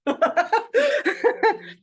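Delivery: a sudden high burst of laughter; bright laugh. Real, not acted. Workshop leader laughing with the group as they agree. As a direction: laugh out loud as the group says yes.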